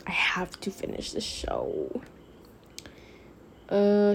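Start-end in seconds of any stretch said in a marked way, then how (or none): 0.1-2.0 slow unvoiced whisper to herself. Real, not acted. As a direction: breathe it out as a private aside. Aside to herself about show.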